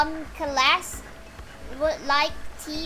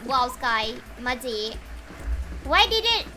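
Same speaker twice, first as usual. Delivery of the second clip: ordinary talk, nothing marked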